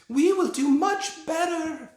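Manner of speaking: put-on very high, loud screech with a Slavic accent; a dad shrieking the witch's scolding; shrieking Grand High Witch. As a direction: shriek it with rolled R's. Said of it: "shrieked the Grand High Witch".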